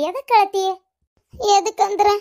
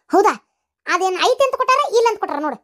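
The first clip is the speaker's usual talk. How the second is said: ordinary delivery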